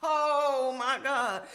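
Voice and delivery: shocked. Real, not acted. Shocked reaction to story.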